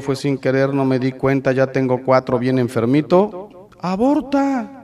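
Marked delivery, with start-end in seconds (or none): 3.8-4.8 drawn-out, high, mocking "Aborta"; sarcastic slow push to abort; mocking advice. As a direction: sell it like cheap advice. Mocking the easy answer.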